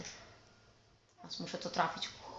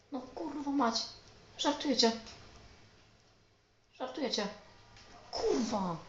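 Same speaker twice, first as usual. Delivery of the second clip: exasperated disbelief; 7 semitones higher than usual. Real, not acted. Gamer in disbelief.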